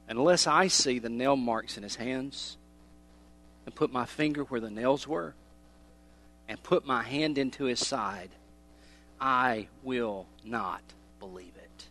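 slow, low and measured; quiet refusal. Acted. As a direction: refuse softly, word by word. Voicing doubting Thomas's refusal.

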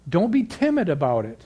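rising, fervent preaching voice; stirring the congregation, climbing in pitch. Acted.

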